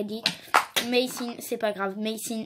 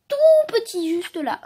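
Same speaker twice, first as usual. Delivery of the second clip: tiny voice; 11 semitones higher, 7 dB louder than usual. Real, not acted. Showing tiny print on card.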